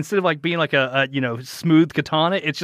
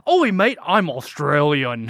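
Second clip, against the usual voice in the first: put-on slow, drawn-out Australian accent; slower than usual. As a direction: ham up a slow Aussie accent. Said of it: "he is a chameleon of accents".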